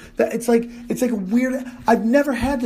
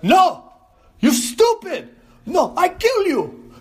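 much higher, louder, slow furious yell; broken-English scream; 13 semitones higher, 5 dB louder, slower than usual. Retold or imitated. Comedian screams at the dealer, high and loud. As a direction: scream in broken nouns and verbs. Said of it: "start screaming like a third world person".

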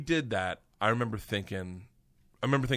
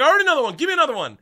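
very high, loud, eager yell; excited demand; 11 dB louder, much faster than usual. Retold or imitated. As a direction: beg loudly and excitedly for more. Eagerly asking Igor in retold scene.

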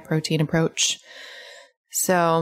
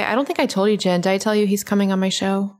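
ordinary delivery

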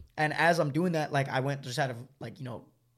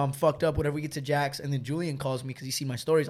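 usual speaking voice

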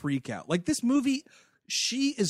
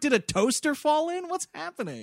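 high, startled "what is going on"; alarmed confusion; 11 semitones higher than usual. Retold or imitated. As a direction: blurt it out in alarm. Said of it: "I was like".

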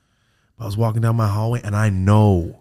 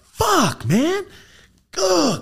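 much higher, louder dismayed groan; exasperated groan; 4 dB louder, much slower than usual. Retold or imitated. Groaning after the medium warns him about the area. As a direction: let it out like bad news landed.

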